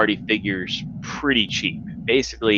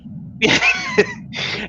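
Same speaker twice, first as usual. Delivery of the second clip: laugh. Real, not acted. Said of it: "I love that accent".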